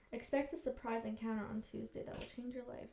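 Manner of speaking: very soft read to oneself; hushed rereading. Real, not acted. Reader going over a freaky fortune, hushed. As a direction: reread it under your breath.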